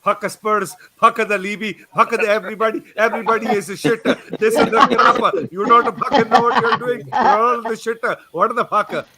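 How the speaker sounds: imitating an angry Welsh fan; Welsh accent. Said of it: "best Welsh accent ever".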